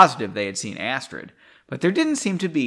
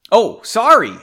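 exclaimed; 7 semitones higher, 9 dB louder than usual. Acted. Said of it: "Astrid exclaimed".

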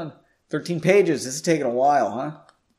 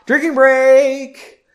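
loud high shout; 12 semitones higher, 12 dB louder, much slower than usual. Real, not acted. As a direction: belt it out, stretched. Reader announces drinking break.

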